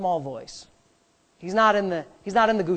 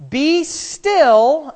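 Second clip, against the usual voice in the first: loud, raised proclamation of scripture; emphatic preaching; 6 semitones higher, 8 dB louder than usual. Real, not acted. Preacher quoting a Psalm to the congregation, emphatic preaching. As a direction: proclaim it to the whole church.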